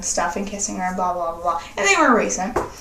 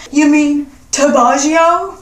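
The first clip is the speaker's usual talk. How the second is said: mocking boyfriend; 3 semitones higher, 5 dB louder than usual.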